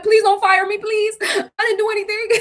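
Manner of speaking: high, loud, crying plea; mock begging. Worker pleading with her boss not to be fired, high mock crying. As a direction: wail it like a desperate employee.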